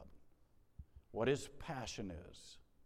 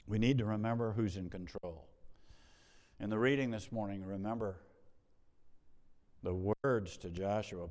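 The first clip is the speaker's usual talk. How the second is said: ordinary delivery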